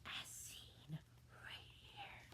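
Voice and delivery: a hushed, breathy aside to the listener; whispered aside. A narrator's reading. Narrator whispers to the child, pointing at the picture.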